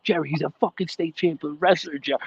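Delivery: retold screaming. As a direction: imitate an intense, worked-up scolding. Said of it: "I started screaming at him".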